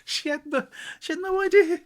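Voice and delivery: high exclamation of disbelief; stunned she had no idea; high disbelieving cry; incredulous. Real, not acted. Exasperated at customer.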